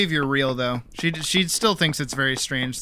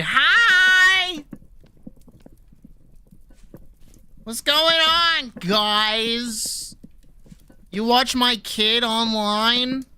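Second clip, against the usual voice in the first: put-on high, slow Massachusetts-accent greeting; Massachusetts accent mom; 8 semitones higher, 3 dB louder, much slower than usual. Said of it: "you'll hear her Massachusetts accent".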